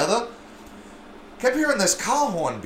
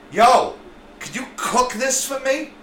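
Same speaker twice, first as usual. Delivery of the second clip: mock street asker; 9 semitones higher than usual. Voices the crackhead asking.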